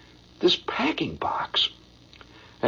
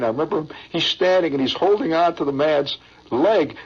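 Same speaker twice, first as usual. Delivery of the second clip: normal talking voice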